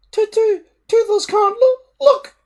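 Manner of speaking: very high, loud stammering wail; a scared dragon who can't watch; moaning little dragon. A narrator's reading. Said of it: "moaned Toothless".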